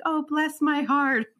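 high, slow, sugary mock drawl; sweet Southern lady. Host imitating Southern women, in a high sugary mock voice. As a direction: put on a syrupy Southern lady voice.

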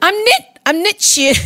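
exaggerated Kiwi accent that misses; attempted New Zealand accent. Shown as a demonstration. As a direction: have a bad go at New Zealand vowels, slipping into South African. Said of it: "should I do a New Zealand accent?".

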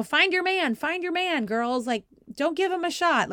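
urgent called-out instructions, imitated; mock parent screaming. Stand-in coach shouting at the girls, mock screaming. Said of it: "every other parent is screaming at them".

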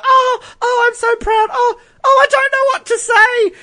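very high, squeaky mock grandma; hammed-up proud grandma, overcome and almost wailing; imitated overwhelmed grandma. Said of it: "she's all like".

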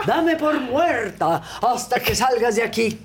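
high, slow, dramatic cry; retold shouted vow. Pita shouts back as they carry her out, dramatic. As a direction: declaim it loudly while being dragged away.

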